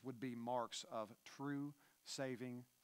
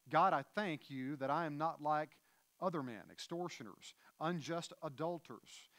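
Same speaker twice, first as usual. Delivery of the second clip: scripture reading; 2 semitones higher, 6 dB louder than usual. Acted. Reads the Luke passage aloud.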